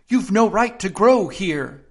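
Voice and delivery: slow, high, huffy little dormouse; indignant dormouse. A narrator's reading. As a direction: scold like an offended dormouse. Dormouse scolds Alice.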